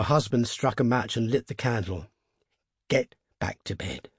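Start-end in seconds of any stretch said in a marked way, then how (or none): 2.9-4.1 low, halting order; low voice, uneasy and hesitant; unsteady. A narrator's reading. Old man trying to calm his wife, unsteadily. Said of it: "he said unsteadily".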